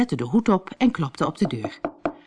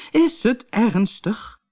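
high, slow whimper; hammed-up plaintive patient; wailing; 6 semitones higher, slower than usual. A narrator's reading. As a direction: wail the worried question slowly. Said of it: "en jammerde".

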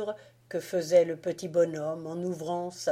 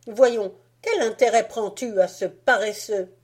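put-on loud, slightly raised bossy boy; Fritz arguing; 3 semitones higher, 5 dB louder than usual. Fritz to Marie demanding the nutcracker, loud and bossy. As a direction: demand it like a spoiled little brother.

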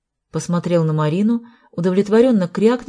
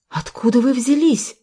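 higher-pitched astonished question; amazed; 6 semitones higher than usual. A narrator's reading. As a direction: ask in startled amazement. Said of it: "изумилась Марина". (изумлённо, повыше)

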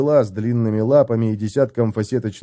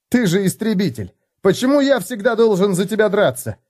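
higher, rising indignation; indignant retort; 9 semitones higher than usual. A narrator's reading. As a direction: throw it back at the dwarf. Felix snapping back at Gotrek. (Феликс огрызается на Готрека, возмущённо)

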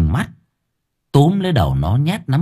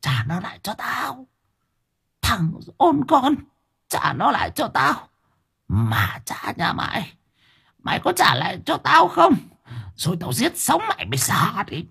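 put-on high, strained old-woman hiss; menacing hiss; 14 semitones higher, 4 dB quieter than usual. A narrator's reading. Grandmother demanding the child back, hissing threats. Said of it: "rít lên".

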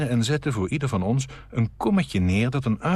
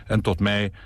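ordinary delivery

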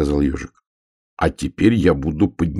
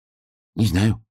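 higher-pitched small hedgehog voice; lightly voiced hedgehog; little hedgehog; 4 semitones higher, slower than usual. A narrator's reading. Hedgehog answers bear in story.

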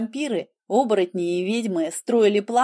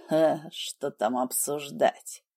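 dismissive snort; 6 semitones lower, 5 dB quieter, slower than usual. Acted. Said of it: "фыркнул Хелвард".

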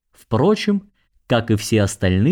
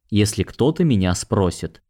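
usual speaking voice

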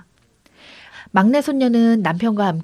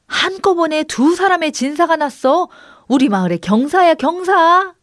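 high, loud, jubilant announcement; joyful praise; 8 semitones higher, 5 dB louder than usual. A narrator's reading. Villagers praising two friends' exam success, joyfully. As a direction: proclaim the good news with delight. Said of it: "기뻐서 칭찬을 아끼지 않았습니다".

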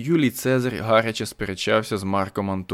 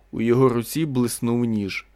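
usual speaking voice

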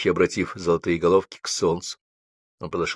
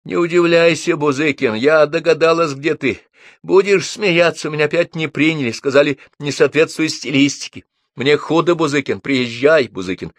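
жалобно, нараспев, высоким голосом; жалуйся в трубку и зови приехать; потерянно; 8 semitones higher, 5 dB louder than usual. A narrator's reading. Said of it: "говорила потерянно". (потерянный, жалобный голос)

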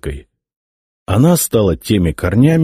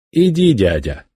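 majestic dismissal; 4 semitones higher than usual. A narrator's reading. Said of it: "величественно махнул рукой Цезарь".

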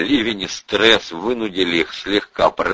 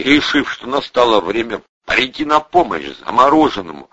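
usual speaking voice

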